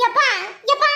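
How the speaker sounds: high, short calls for dad; calling out. Acted. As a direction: call your father over to complain. Child calling out to father. (அப்பாவை உரக்கக் கூப்பிடுதல்)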